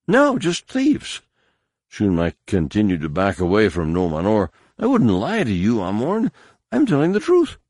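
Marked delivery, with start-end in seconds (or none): 0.1-1.2 frantic, much higher, pleading. A narrator's reading. Captive pleading. 4.8-7.6 frantic, much higher, pleading. A narrator's reading. Whimpering captive.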